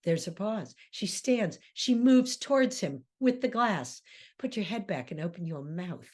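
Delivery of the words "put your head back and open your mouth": soft command. Retold or imitated. Ruth commanding Lenny.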